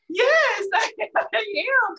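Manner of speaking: tearful laughing yes; laughing through tears. Real, not acted. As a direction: choke out the answer between laughs.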